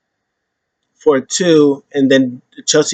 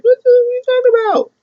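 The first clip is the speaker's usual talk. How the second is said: much higher, fast squeal of disbelief; shocked outburst; faster than usual. Retold or imitated. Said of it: "I was like".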